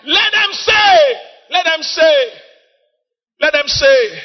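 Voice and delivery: high, loud, drawn-out preacher's shout; full-force preaching. Real, not acted. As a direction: drive the scripture home to the congregation.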